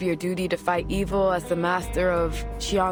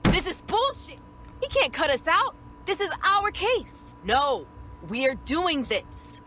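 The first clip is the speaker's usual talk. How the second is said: much higher, put-on outraged character voice; indignant protest; 13 semitones higher, 5 dB quieter than usual. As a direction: protest hotly in a high-pitched voice. Benched partner protests the decision.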